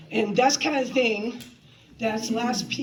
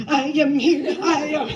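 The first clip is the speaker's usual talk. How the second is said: mock loud rushing; 4 semitones higher, 8 dB louder than usual. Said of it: "It was like".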